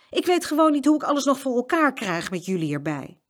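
high, fretting complaint; exasperated mother. A narrator's reading. Mama to the twins about her day, exasperated. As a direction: grumble as a harried parent.

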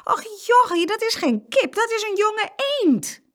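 high, loud, tickled exclamation; amused correction. A narrator's reading. Farm couple correcting boy about duckling, amused. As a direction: set the child straight, high and amused.

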